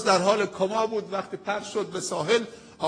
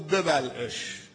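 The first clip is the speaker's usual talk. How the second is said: God's command; 4 semitones lower, 4 dB quieter, much slower than usual. Acted. God commands the whale.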